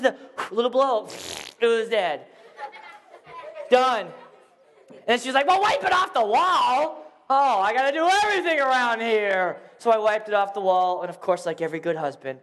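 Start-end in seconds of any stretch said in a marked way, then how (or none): 5.5-9.6 bossy wife. Retold or imitated. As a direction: play the demanding wife. Wife ordering husband.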